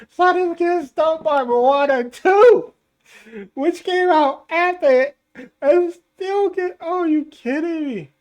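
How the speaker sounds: high, loud, worked-up disbelief; excited disbelief. Real, not acted. Exasperated at box office chart.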